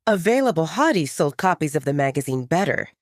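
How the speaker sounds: ordinary delivery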